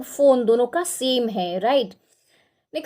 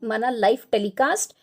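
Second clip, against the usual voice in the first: usual speaking voice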